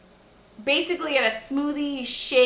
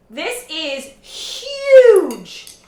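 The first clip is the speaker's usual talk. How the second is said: drawn-out, louder amazed exclamation; 5 semitones higher, 9 dB louder, much slower than usual. Real, not acted. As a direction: stretch huge out in awe. Showing off the big food.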